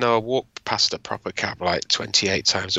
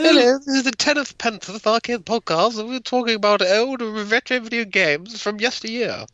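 high, put-on posh presenter voice; 11 semitones higher than usual. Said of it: "Perhaps have a posh voice on then".

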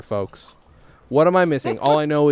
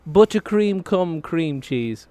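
slow, higher, mock British accent; old lady's Latin; 4 semitones higher, much slower than usual. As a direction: spell out the list slowly like an old British baker. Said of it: "she says".

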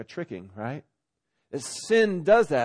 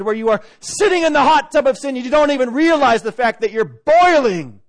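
louder, higher impassioned warning; urgent preaching; 6 semitones higher, 7 dB louder than usual. Real, not acted. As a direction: preach it hard, full of urgency. Pleading warning in sermon.

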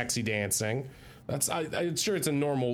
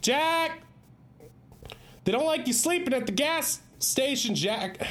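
high, pleading call; calling after someone; 10 semitones higher than usual. Acted. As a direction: call out to stop him leaving. Calling after brother who walks out.

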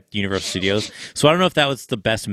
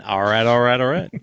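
Matthew McConaughey impression. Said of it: "my circus performer version of Matthew McConaughey".

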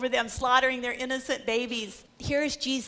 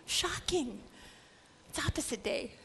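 whispered aside; 10 dB quieter than usual. Real, not acted. Hushed aside on shock.

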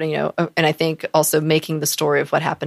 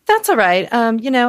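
breezy taunt; 6 semitones higher, 5 dB louder than usual. Retold or imitated. Taunting boyfriend with fake date.

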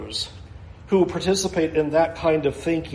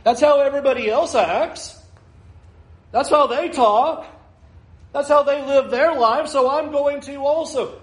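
mocking excuse; 6 semitones higher than usual. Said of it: "We want to play the same game with God".

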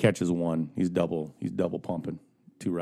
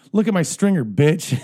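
loud, high gloating comeback; triumphant taunt; 9 semitones higher, 8 dB louder, slower than usual. Retold or imitated. Kid taunting the old man, loud gloating shout. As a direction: shout it over your shoulder.

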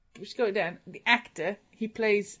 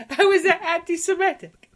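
mock outrage; 9 semitones higher, 8 dB louder than usual. Real, not acted. Indignant protest.

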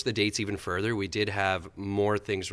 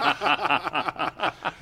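laughing; 7 semitones higher than usual. Real, not acted. Laughing at own story.

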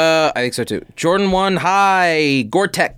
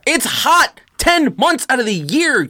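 indignant high protest; heated insistence; 9 semitones higher, slower than usual. Real, not acted. Defending wearing sneakers.

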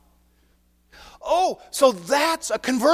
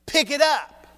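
higher, firm divine order; final command; 6 semitones higher, slower than usual. Acted. God insisting a final time on the snake. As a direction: give the order one last time.

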